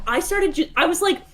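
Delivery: ordinary talk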